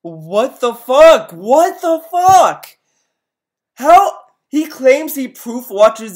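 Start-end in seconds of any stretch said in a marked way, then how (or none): 0.0-2.7 loud, drawn-out disbelief; shocked yell. Real, not acted. Stunned by a glitch in the clip. 3.7-4.2 disbelieving shout. Real, not acted. Disbelief at the clip.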